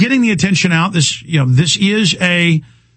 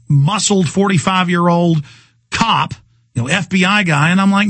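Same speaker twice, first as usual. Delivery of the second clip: ordinary talk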